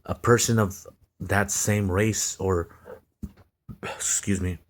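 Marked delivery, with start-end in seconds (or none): none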